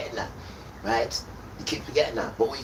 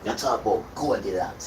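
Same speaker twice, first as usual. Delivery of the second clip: ordinary delivery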